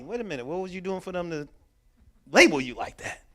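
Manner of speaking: ordinary talk, nothing marked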